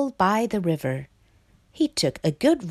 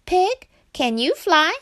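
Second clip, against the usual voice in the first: high-pitched frog calling a friend; frog character; 10 semitones higher, 4 dB louder than usual. A narrator's reading. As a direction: pipe up as the frog, high and eager to ask. Frog's voice in story.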